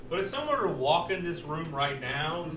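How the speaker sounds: ordinary talk, nothing marked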